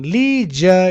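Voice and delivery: LEISURE as "LEE-zha"; American pronunciation. Shown as a demonstration. Teacher repeating American leisure for students, "lee-zha". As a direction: stretch LEISURE's first vowel to ee. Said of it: "in American".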